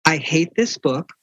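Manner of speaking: slow, measured reading of a harsh letter; reading someone else's harsh words, drawn out; deliberate readout. Retold or imitated. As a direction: spell out each word slowly. Reads a harsh rejection letter aloud.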